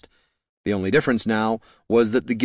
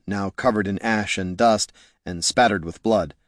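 ordinary delivery

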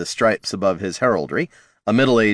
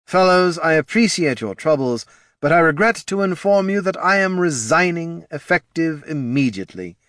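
put-on drunken resignation; 8 semitones higher than usual. A narrator's reading. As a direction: announce it drunk and pompous. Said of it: "he said to the floor".

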